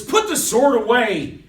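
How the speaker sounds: loud, high order to stop; commanding shout. Acted. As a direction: shout a firm command at someone with a drawn sword. Jesus commands Peter during the arrest.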